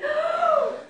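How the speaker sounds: big high gasp; deep breath. Acted. Jumping Mouse readies to run, big audible breath. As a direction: suck in air before a dash. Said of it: "took his deep breath".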